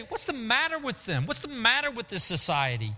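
hammed-up exasperated complaint; mock exasperation. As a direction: play a fussy hand-wringer. Mock hand-wringing Jesus never said.

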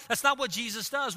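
ordinary talk, nothing marked

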